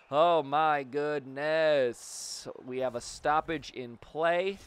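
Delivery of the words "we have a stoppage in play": ordinary talk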